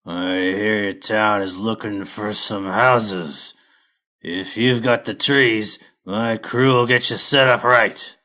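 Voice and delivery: slow, loud growl of a hairy brute; growling lumber boss. Acted. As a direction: drag the words out like a tough crew boss. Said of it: "stuck his bristly chin out and growled".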